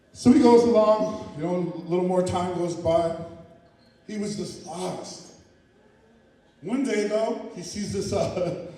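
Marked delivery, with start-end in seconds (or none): none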